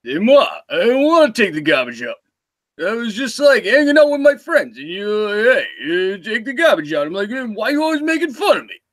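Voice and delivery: put-on slurred, whiny teenage tough guy; Sylvester Stallone as a teenager. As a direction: complain to Ma in a hammed-up, swooping mumble. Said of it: "I'm gonna do Sylvester Stallone as a teenager".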